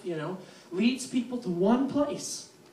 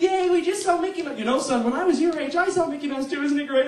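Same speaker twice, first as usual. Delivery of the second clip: cheery dad; 6 semitones higher than usual. Retold or imitated.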